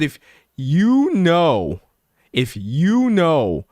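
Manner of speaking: YOU KNOW drawn out slowly with a big pitch swoop; emphatic repetition. Real, not acted. Streamer ranting at no-shows, "you know" stretched for emphasis. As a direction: stretch "you know" long and high, then let it fall. Emphatic condition in pet peeve rant.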